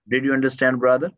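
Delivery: ordinary delivery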